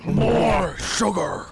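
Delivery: very slow slurred bug-man drawl; cockroach voice. Acted. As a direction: drag it out, cheeks loose. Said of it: "you gotta shake the cheeks".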